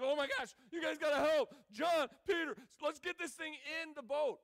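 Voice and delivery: loud, high, frantic call for help; excited shout. Retold or imitated. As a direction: yell to your friends as the net drags down. Disciples hauling overflowing nets.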